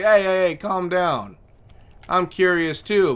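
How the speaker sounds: a cat telling the pup to calm down; scolding cat voice. Retold or imitated.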